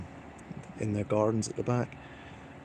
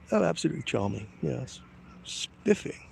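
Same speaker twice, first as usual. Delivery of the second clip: mock posh; 5 semitones higher than usual. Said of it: "like they're sucking on a plum".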